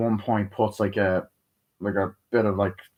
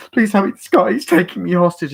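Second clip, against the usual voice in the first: high, louder damsel-in-distress plea; hammed-up high-pitched pleading; mock pleading; 10 semitones higher, 6 dB louder than usual. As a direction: imitate a hostage begging for help. Repeats the hostage's plea.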